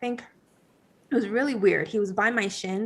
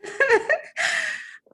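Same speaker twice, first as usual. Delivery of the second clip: real laughter trailing along with the host; high laugh. Real, not acted. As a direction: laugh freely.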